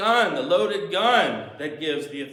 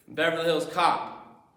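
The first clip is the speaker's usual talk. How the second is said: ordinary delivery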